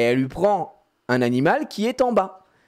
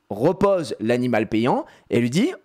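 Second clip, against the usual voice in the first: ordinary delivery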